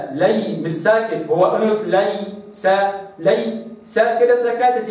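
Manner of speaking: ordinary delivery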